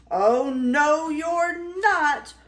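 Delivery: loud, rising roar of refusal; roaring troll. Acted. Troll refusing Baby Gruff, loud rising roar. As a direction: roar it out, climbing in pitch. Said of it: "roared the troll".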